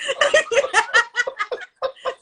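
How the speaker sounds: high, loud laugh at herself; high delighted laugh. Real, not acted. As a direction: break into laughter at your own story.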